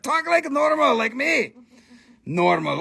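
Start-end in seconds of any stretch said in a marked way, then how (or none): 0.0-1.5 high, exasperated retold rant; exasperated outburst. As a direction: burst out at the whisperer, imitating outrage. Retelling outburst at whispering friend.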